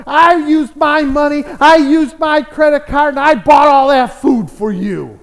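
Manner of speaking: acted exasperated neighbor setting her straight; indignant atheist. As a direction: argue it forcefully, insisting on the credit. Atheist insists he bought the food.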